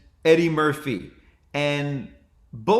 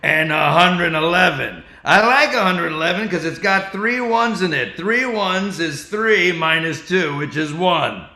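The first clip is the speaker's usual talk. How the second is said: loud, deliberate Carlin rhythm; George Carlin impression; 3 semitones higher, 5 dB louder than usual. Comic continuing his Carlin riff, loud rhythmic delivery. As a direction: count off numbers like Carlin. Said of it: "I go".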